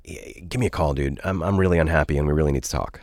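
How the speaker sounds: normal talking voice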